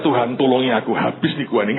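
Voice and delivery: ordinary delivery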